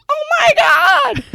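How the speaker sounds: put-on high squealing girly "Oh my God"; shrieking girls. Girls in the crowd shriek at his dancing, high and squealing.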